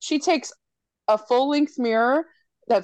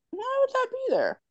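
high squeaky put-on voice for the girl in the movie; mock character voice; 6 dB quieter than usual. Friend retelling a horror movie, squeaky mock heroine voice. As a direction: voice her puzzled, way up high.

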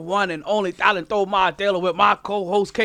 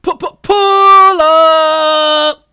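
slow, stretched, loud DJ call; Jamaican hype shout; 9 semitones higher, 13 dB louder, much slower than usual. Shown as a demonstration. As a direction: hype it up dancehall style. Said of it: "run that back one more time".

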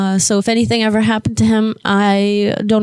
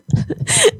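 high breathless laugh. Real, not acted. Laugh after retold scream.

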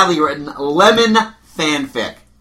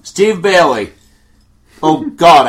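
ordinary talk, nothing marked

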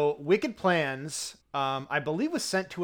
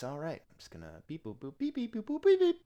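mouth dialling beeps; 4 semitones higher, 4 dB quieter than usual. Pretend.

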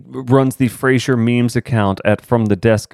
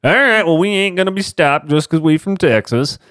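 mock defiant Texan; 8 semitones higher than usual.

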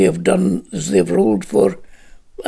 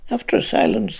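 ordinary delivery

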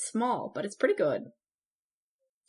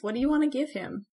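ordinary talk, nothing marked

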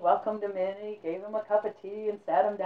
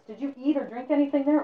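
alarmed question; 8 semitones higher, 5 dB louder than usual. Acted. Old man alarmed questioning.

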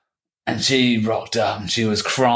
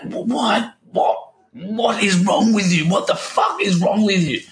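dad shouting at him; 11 semitones higher than usual. Retold or imitated. Son retells his dad yelling at him in hospital, high outraged imitation. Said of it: "He was like".

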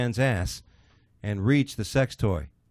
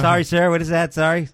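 raised, high voice to someone inaudible; loud desk clerk; 6 semitones higher, 7 dB louder than usual. Acted. ER clerk to a whispering patient, loudly. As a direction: ask loudly, as if you can't hear.

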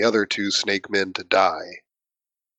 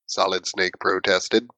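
ordinary talk, nothing marked

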